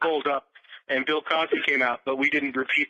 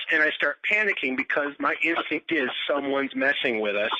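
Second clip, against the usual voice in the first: normal talking voice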